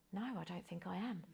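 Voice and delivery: barely audible, the voice dropping away; quiet admission. Retold or imitated. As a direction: let the truth slip out softly. Admitting she is not okay.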